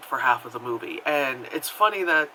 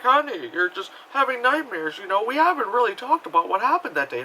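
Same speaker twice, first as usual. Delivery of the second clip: higher-pitched, placating mock husband; dismissive husband voice; 7 semitones higher than usual. Husband brushes off his wife's nightmares, mock placating. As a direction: soothe her in a put-on, condescending lilt. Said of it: "the husband is just like".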